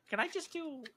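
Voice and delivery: ordinary talk, nothing marked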